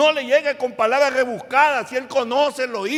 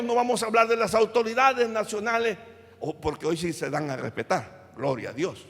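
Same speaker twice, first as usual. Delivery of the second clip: ordinary delivery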